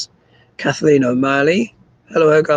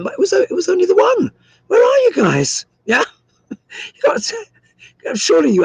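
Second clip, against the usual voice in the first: high, bright-pitched teasing call with a laugh; playful calling out; 4 dB louder, faster than usual. Real, not acted. Host playfully calling out to chat.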